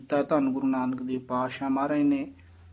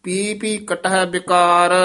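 measured chanting recitation of Gurbani; scripture recitation; 4 dB louder, slower than usual. Shown as a demonstration. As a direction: recite each phrase slowly and evenly.

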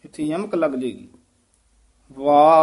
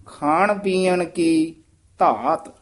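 ਖਾਣ ਪੀਣ ਕੀ very slow; slow after pause; much slower than usual. Shown as a demonstration. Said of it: "ਇੱਥੇ ਵਿਸਰਾਮ".

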